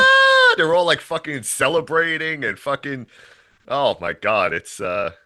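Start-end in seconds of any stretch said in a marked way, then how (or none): 0.0-0.5 loud, very high cheering scream; mock crowd cheer. Townspeople greeting the second car, mock cheering scream. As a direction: scream like a fan greeting rock stars. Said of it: "treated like fucking rock stars".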